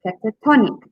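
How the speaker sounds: cata-TON-ic, TON hit strongly; stress on second-last syllable. Shown as a demonstration. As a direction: punch the TON in catatonic. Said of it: "second last syllable is stressed".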